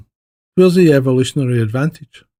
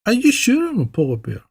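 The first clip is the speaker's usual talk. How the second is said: higher, small voice of a cub; lightly voiced anxious cub; polar bear cub; 9 semitones higher than usual. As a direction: ask like a worried little cub. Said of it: "the polar bear cub goes".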